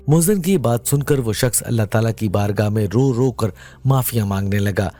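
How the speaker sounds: usual speaking voice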